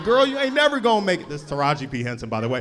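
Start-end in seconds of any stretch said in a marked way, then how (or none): 0.0-1.3 method-acting yell. Villain yelling at the heroine on set. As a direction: yell it at her like a film villain. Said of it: "I'm over here yelling".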